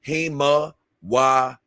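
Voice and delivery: slow HIMA WARE, syllable by syllable, ending in "ray"; demonstrated name pronunciation. Shown as a demonstration. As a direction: sound out HIMA WARE slowly as hee-ma-wa-ray. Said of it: "that's how I say it".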